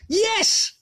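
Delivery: sudden high exclamation of remembering; real eureka moment; excited yes. Real, not acted. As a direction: blurt it out as the thought hits.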